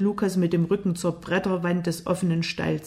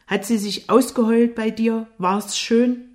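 higher, mocking questions; jealous taunt; 4 semitones higher, 5 dB louder than usual. A narrator's reading. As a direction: needle him with raised pitch. Eifersüchtiger Jimmy verhöhnt Lukas. (eifersüchtig höhnisch)

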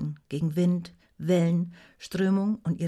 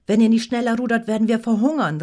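loud, raised warning; forceful, higher, urgent call; 4 semitones higher, 9 dB louder than usual. A narrator's reading. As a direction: shout a threat to drive the rowers. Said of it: "rief Rognet".

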